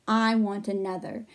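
ordinary delivery, nothing marked

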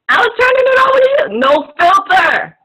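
high, loud call to the co-host; exasperated shout. Real, not acted. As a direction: rush the complaint, then stretch the title loudly. Calls out after missed handover.

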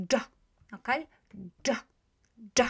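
"D" as a quick blended dż; very short, one sound. Shown as a demonstration. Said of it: "Try to make it very".

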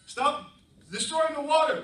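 high, loud scolding plea; dog's scolding. Acted. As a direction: cry it out up high. Dog scolding humans.

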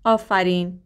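ordinary talk, nothing marked

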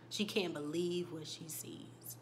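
normal talking voice

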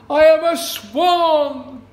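high, very loud, slow triumphant cry; new swan exulting at last; joyful shout. Acted. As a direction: shout it to the sky. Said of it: "he cried".

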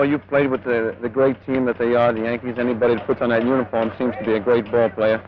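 normal talking voice

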